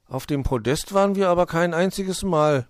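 plain reading voice

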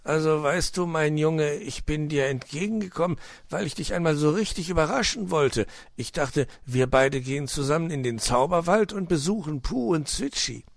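lightly acted storybook uncle; fox uncle explaining. A narrator's reading. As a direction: talk a child round, cajoling.

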